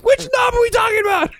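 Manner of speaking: very high, loud panicked squawk; hammed-up shriek of nervous confusion; mock panic. Jumpy apprentice asking his boss which knob, mock panicked. Acting out being on pins and needles.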